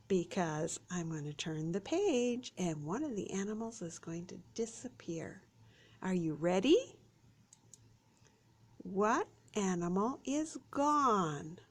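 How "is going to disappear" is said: whisper. Acted. Building suspense for children.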